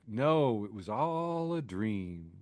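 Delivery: slow, soft, drawn-out denial; dreamy mock denial. Host imitating Iran answering the president, lightly voiced slow denial. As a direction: stretch it out softly, lightly voicing a denial. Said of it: "Iran is like".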